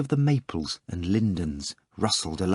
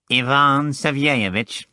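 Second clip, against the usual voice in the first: slow, higher, nasal inquiry; repugnant nasal voice; 4 semitones higher, slower than usual. A narrator's reading. Phone caller asking for the manager, repugnantly nasal. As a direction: make it unpleasantly nasal and drawn out. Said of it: "a most repugnant nasal voice".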